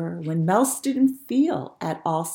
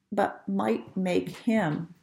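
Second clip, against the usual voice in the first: ordinary delivery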